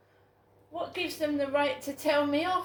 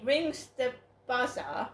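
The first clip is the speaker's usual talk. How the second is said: ordinary delivery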